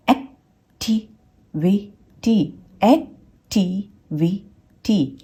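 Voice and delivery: Activity slowly split into एक, टि, वि, टी; four separate beats in activity with gaps; syllable by syllable. Shown as a demonstration. Teacher counts out four syllables, activity broken slowly into pieces. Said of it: "चार syllable word है".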